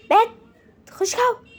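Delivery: high, loud order to get out; sharp shooing order. Real, not acted. Mother ordering the kids out, high and loud. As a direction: snap them out of the room.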